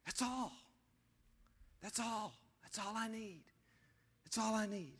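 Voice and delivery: very soft, spaced-out repeats; quiet, contented refrain with long pauses; hushed declaration. Acted. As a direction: drop to a near-hush, pausing between repeats. Hushed repeated declaration after loud preaching.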